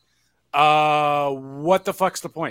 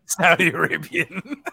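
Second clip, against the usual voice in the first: laughing echo; 3 semitones higher than usual. Real, not acted. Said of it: "I corrected myself".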